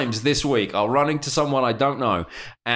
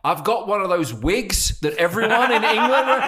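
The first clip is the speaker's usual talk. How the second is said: mock eager offer; 8 semitones higher than usual. Offering strangers a judge's wig with mock eagerness. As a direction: sell the wig gag with fake enthusiasm. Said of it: "My new thing's gonna be".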